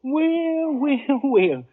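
put-on old storekeeper's high, drawn-out well well well; drawn-out greeting. Old storekeeper greeting the long-absent cowboy. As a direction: savor each well.

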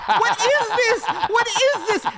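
high, loud, laughing squeal; laughing disbelief. Retold or imitated. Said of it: "they're just like".

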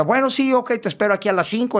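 high, campy phone agreement; imitated performer. Transvestite performer sets a meeting by phone, high camp voice. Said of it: "este vato me pregunta".

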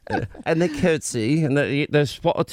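badly faked British accent; hesitant, hammed-up British impression; Katie. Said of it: "is this your imitation of Katie".

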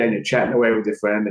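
usual speaking voice

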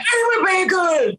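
put-on high, drawn-out squeaky little kid voice; little boy's voice. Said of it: "his little voice in it".

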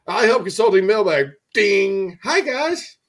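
high, loud cheery mailman jingle; Mister McFeely voice. Pretend. Cartoon mailman opening the mailbag for listeners, high and cheery. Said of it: "a graphic of him going".

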